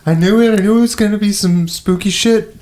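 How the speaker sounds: high, panicky repeated cry; scared squeal. Retold or imitated. Player retelling her terrified reaction, high panicky voice.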